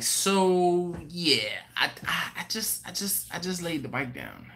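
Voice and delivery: sheepishly upbeat, stammering retold confession; too happy. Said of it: "You sound too happy".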